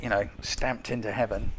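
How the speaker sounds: usual speaking voice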